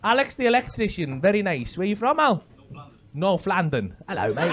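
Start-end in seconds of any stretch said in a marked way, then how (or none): 3.1-4.5 low, soft mimicked London accent. Comic echoing audience member's North London, mock London accent. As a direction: echo the North London accent, lower and softer. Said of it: "I hear an accent".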